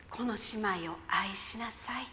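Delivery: soft unvoiced whisper of the Lord; ささやく主の声. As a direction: breathe it like a divine whisper. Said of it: "ささやき出したんです". (ささやき声で)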